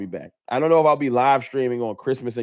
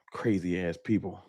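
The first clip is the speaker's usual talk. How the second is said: low, quiet disgusted grumbling; real irritation, held down low; pissed off; 7 semitones lower, 9 dB quieter than usual. Real, not acted. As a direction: grumble it to yourself, fed up. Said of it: "Pissed me off".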